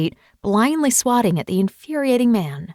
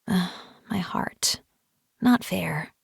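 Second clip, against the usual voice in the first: slow, breathy whispered sigh of a melted heart; whispered aside; 5 dB quieter, slower than usual. Acted. Mother melting at her son's greeting, slow whisper.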